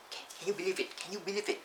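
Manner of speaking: high, incredulous question; can you believe it, up high; amazed disbelief. Real, not acted. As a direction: sound truly amazed it's so fast. Disbelief at speed.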